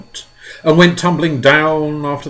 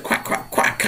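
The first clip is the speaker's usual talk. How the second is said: duck quack. Retold or imitated. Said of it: "the ducks said".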